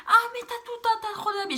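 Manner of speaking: high, loud mocking parent voice; mocking her parents. Girl mimicking her parents who refuse to buy clothes, high mocking voice.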